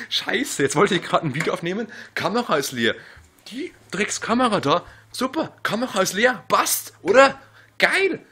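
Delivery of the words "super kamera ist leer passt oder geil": loud, high sarcastic yell; yelling sarcastic praise in fury; berserk rage. Real, not acted. (laut und sarkastisch wütend)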